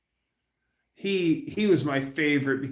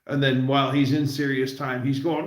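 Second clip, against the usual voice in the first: ordinary delivery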